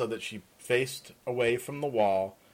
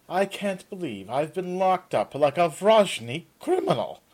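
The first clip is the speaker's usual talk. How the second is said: whiny, indignant complaint; a hint of an aggrieved young man; complaining; 8 semitones higher, 6 dB louder than usual. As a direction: grumble as a wronged prisoner. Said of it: "Aaron complained".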